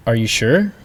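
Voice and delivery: "sure" climbing in pitch; rising question. Shown as a demonstration. Teacher giving an English example, "sure" rising. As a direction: ask it with a clear lift on "sure". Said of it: "make a rising tone at the end of a question".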